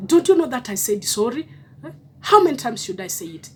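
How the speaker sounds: loud, impatient "how many times" protest; mock exasperation. Partner who already said sorry, mock irritation. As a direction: play the fed-up partner, a bit hammed.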